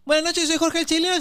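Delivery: high, loud showman intro; mock stage intro. Comic replaying his own intro, high and showy. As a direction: announce yourself like a nervous emcee.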